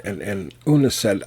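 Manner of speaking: usual speaking voice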